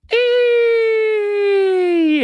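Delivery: long, loud, high "eee"; high mocking squeal. Mocking Gerrard's hopes of touching Europe, high squeal. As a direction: squeal one piercing, drawn-out note.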